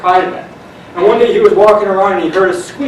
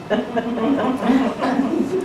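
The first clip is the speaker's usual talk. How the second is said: soft laugh; 5 semitones higher, 8 dB quieter than usual. Real, not acted. Laughs after punchline.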